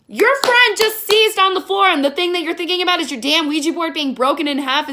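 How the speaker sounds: loud, indignant telling-off; exasperated rant. Pretend. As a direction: chew out the characters in disbelief. Rants at film's characters.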